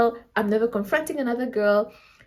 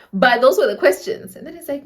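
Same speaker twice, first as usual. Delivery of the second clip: ordinary talk, nothing marked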